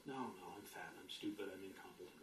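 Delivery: low, soft, defeated murmur of the inner critic; whiny inner voice. Retold or imitated. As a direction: let the little block complain quietly to itself. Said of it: "It's going".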